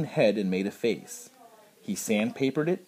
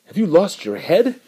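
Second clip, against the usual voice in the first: high, louder furious taunt; raging guard; 9 semitones higher, 7 dB louder than usual. A narrator's reading. Guard yells at the man, furious and high. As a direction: fling it at him in a rage. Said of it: "went on the guard in a rage".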